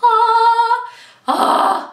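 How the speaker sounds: high sung fangirl squeal; swoony sung ah; excited squeal. Real, not acted. Gushing over the romance scene.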